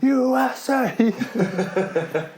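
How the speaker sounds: high, long-drawn chant-like yell; imitated screaming. Coach cheering every match, imitated high drawn-out scream. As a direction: stretch it out in a high mock scream. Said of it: "Just screaming every match".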